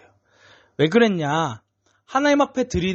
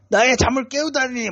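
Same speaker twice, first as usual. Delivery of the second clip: mock fury; 8 semitones higher than usual. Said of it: "엄청 화를 냈을 것 같아요".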